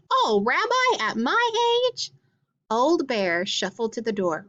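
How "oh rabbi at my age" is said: put-on very high, flustered old-lady squeal, drawn out slowly; coy and flattered, pitch way up; blushing grandmother. A narrator's reading. Flattered grandmother after the bear licks her face, high coy squeal. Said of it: "Baba Brana blushed".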